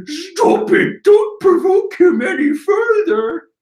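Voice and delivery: high-pitched mock shouting; panicking minion. Nameless minion begging them to stop, high panicked shout. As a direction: cry out in a high, alarmed henchman voice. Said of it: "nameless minion just stating the obvious".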